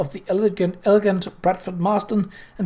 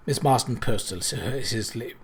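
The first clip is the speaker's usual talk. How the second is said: ordinary delivery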